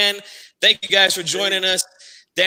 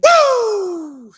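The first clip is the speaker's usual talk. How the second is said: high whooping shout; woo cheer; 13 semitones higher, 4 dB louder than usual. Real, not acted. Host answers the cheer with a high whoop. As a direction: let out a celebratory whoop.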